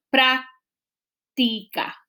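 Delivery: práctica broken slowly into prác, ti, ca; syllable by syllable. Shown as a demonstration. Teacher showing the chin-tap syllable trick, syllable by syllable. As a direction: sound out práctica with gaps between syllables. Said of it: "la separación de las sílabas de esa palabra".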